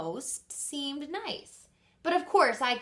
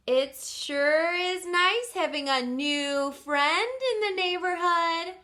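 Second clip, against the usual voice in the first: pointed hint; 7 semitones higher, 5 dB louder, slower than usual. Acted. Hinting to dad loudly.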